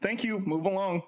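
imitated singsong send-off; mock dismissal. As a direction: shoo someone away, retold for laughs. Retelling Nick brushing off the lady.

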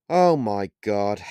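slow, loud "Oh my God"; exclaiming. A narrator's reading. Hank hearing about Holly's new man, slow and loud in astonishment. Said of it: "exclaimed Hank".

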